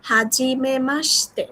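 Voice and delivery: Hajime মাসতে said slowly for the class to echo; slow model for repeating. Shown as a demonstration. Teacher asks the class to repeat after her, slowly. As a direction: lay it out slowly so they can repeat. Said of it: "আমার থেকেও better আপনি বলছেন".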